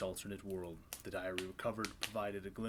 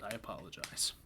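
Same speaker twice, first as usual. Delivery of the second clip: normal talking voice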